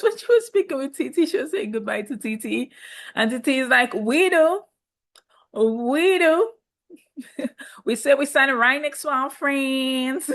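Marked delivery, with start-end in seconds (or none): 3.9-10.3 mock American accent, pitched higher; a hammed-up American accent, light and playful. Quoting TT saying goodbye, in a mock American accent. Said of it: "That's my American accent".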